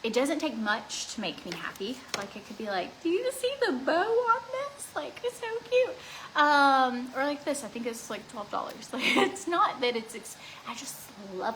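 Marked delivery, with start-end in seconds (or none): 3.0-6.0 put-on high, girly gushing voice; cutesy squeal. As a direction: squeal over the bow like a little girl. Imitating herself gushing over a bow.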